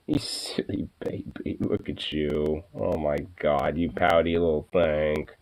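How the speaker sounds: hushed affectionate murmur; tender pet cooing. Real, not acted. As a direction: coo softly to a beloved dog. Cooing at the dog up close.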